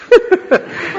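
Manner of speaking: high breathy giggle; real laughter; laughing. Real, not acted. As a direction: break into laughter. Laughing at own joke.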